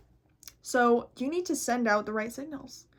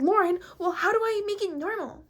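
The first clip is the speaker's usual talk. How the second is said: lightly higher imitation of a viewer; a hint of a silly viewer; mocking viewer voice; 7 semitones higher than usual. Lightly mocking a viewer's question. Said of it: "you don't sound like that".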